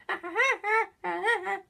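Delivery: high cartoon woodpecker cackle; squeaky mocking cartoon laugh; Woody Woodpecker laugh. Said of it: "he does that, that laugh".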